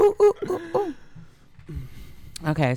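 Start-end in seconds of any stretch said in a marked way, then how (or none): 0.0-0.9 high excited ooh calls; excited squeal. Real, not acted. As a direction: squeal with glee. Squealing at jungle sex joke.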